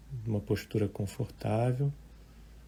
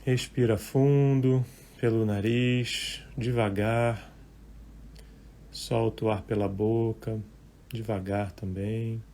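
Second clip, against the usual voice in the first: guided meditation; 9 dB quieter than usual. Real, not acted. Meditation teacher leading live listeners through breathing and thoughts, calm and unhurried. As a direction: guide listeners gently, leaving long silences between short phrases.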